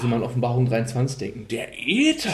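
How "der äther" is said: dramatic quote. Retold or imitated.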